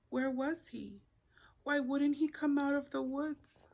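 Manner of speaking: plain reading voice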